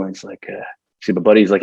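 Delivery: ordinary talk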